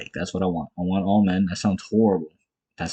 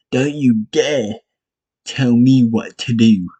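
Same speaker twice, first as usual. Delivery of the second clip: mock tough guy; 3 semitones higher, 5 dB louder, slower than usual. Quoting a fighter's promo line.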